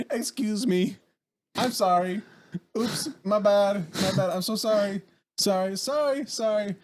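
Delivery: high, sing-out string of apologies; overly apologetic. Retold or imitated. Shopper pushing between arguing strangers, overly apologetic. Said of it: "Just being overly apologetic for no reason".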